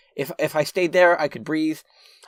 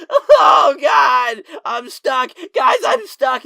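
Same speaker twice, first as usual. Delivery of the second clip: high, loud, whiny panic; freaking out; 10 semitones higher, 9 dB louder, much slower than usual. Retold or imitated. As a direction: cry out to the guys in mock desperation. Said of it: "that's when I started freaking out".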